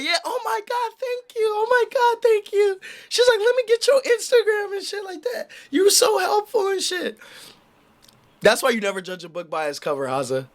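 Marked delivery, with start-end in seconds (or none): 0.0-7.2 put-on high, gushing girlish thanks; grateful girl. Girl thanking the streamer for finding her phone, high and gushing.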